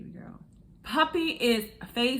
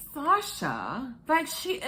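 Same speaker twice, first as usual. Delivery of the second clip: laughing in disbelief; 10 semitones higher, 5 dB louder, slower than usual. Real, not acted. Exasperated cringing reaction, laughing.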